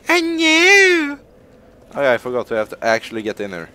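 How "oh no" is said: very high, drawn-out fake cry; hammed-up high wail of dismay; mock wailing. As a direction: squeal it as a pretend victim. Mock wail of the hit foe.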